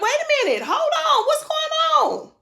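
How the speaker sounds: very high, panicked questions; startled and confused; alarmed. Retold or imitated. Retold confusion while floating.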